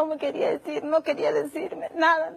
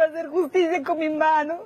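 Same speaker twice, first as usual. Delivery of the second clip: usual speaking voice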